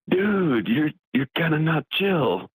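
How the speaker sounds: high, very slow stoner drawl; laid-back hippie. Retold or imitated. A mellow boomerang hippie calming an aggressive guy, slow and high.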